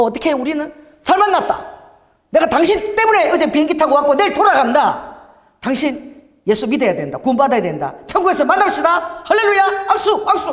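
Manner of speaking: beam and push the greeting at a stranger; laughing excitement. Retold or imitated. Evangelist greeting a Japanese stranger on the street, excited and laughing. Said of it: "막 웃으면서".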